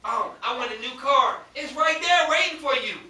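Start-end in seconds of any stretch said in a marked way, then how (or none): 1.6-2.9 raised, emphatic preaching. Real, not acted. Preacher promises the waiting blessing, raised emphatic preaching. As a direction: promise it to the congregation with force.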